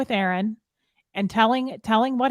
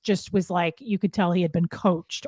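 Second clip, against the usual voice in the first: usual speaking voice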